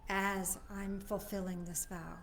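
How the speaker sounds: hushed, fading prayer voice. Acted. A soft prayer to close the vow. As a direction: pray it softly, letting it fade.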